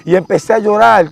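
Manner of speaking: ordinary delivery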